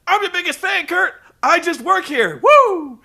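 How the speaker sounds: high, loud, hammed-up pleading; Ric Flair begging off. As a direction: plead your innocence like a cowardly showboat. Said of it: "Rick starts begging off".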